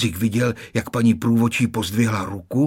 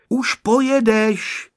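calling out; 11 semitones higher, 4 dB louder, slower than usual. A narrator's reading. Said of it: "vykřikla maminka".

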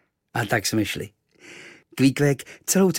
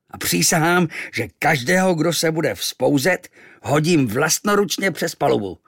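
roaring captain; 3 semitones higher, 6 dB louder than usual. A narrator's reading. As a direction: thunder at the crew and swear vengeance. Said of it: "Loupl po nás okem a zařval". (řvoucí, hrozivý kapitán)

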